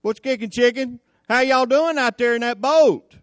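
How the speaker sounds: high, loud, playful hello; breezy greeting. Acted. Jesus hailing the disciples as he sashays by, mock-breezy. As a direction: call out casually as if strolling past. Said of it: "sashayed by 'em, you know, like".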